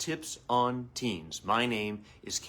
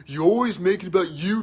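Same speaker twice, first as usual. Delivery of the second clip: son complaining; 7 semitones higher, 7 dB louder than usual. Retold or imitated. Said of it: "he's told me a few times now".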